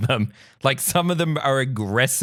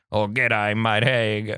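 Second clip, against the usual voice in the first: hammed-up Aussie greeting, half-hearted; thick Australian accent, badly faked; true Australian accent; 5 semitones lower than usual. Shown as a demonstration. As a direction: attempt a broad, low Australian drawl. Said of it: "consider the true Australian accent".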